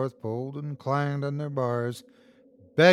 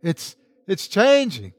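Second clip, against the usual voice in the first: slow, raised, stammering call of dread; called back; 6 semitones higher, 5 dB louder than usual. A narrator's reading. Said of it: "called back".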